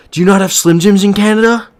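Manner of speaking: high, loud, incredulous question; shocked disbelief. Real, not acted. Streamer reacting to Canadian chat, loud and high in disbelief. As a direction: gasp it out like it's unthinkable.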